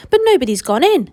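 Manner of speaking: high, loud outraged shout; shouting crowd. Acted. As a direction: yell it at the locked gates. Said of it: "the people shouted".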